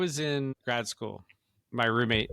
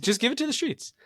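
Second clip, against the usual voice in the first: higher, lightly put-on roommate voice; imitating roommate, higher; 12 semitones higher than usual. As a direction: mimic Craig's breezy advice. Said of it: "He's like".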